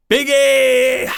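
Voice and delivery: big high announcer shout. Pretend. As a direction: belt out the name. Announcing the star.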